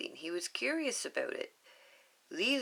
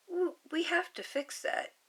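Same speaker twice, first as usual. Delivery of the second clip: put-on higher child voice, stammering start; little girl voice; 11 semitones higher than usual. Lilu telling Stitch, higher child voice. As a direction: stumble then state it like a little girl.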